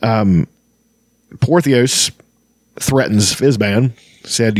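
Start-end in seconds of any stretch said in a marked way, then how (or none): none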